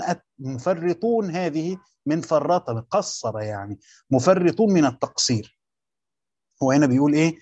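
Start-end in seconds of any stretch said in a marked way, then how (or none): none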